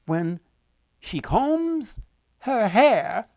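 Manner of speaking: high, loud incantation of fate; destiny spell. Acted. Three women chant the girl's new destiny, high and loud. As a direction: cast the spell in a soaring voice.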